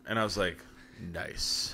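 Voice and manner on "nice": whispered, very soft reaction; hushed approval. Retold or imitated. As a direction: whisper it under your breath in approval. Recounts own quiet approving reaction.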